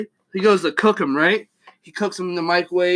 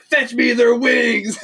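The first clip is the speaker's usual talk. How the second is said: slow, raised, drawn-out battle cry; mock commanding villain; 5 semitones higher, slower than usual. Storyteller voicing the leader of a dog mob, mock-epic command. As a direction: order your horde in hammed-up fashion.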